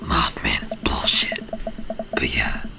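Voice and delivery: very low, whispery vigilante voice; deep hushed hero rasp, slipping a little; Batman. Acted. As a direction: play a brooding masked crimefighter. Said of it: "my Batman voice is fading out".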